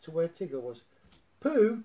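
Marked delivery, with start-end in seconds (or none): 1.4-1.8 loud, higher hail. A narrator's reading. Tigger calls out to Pooh, loud. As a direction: call across the path. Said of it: "Tigger called".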